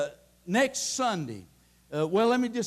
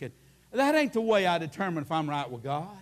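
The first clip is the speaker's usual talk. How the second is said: normal talking voice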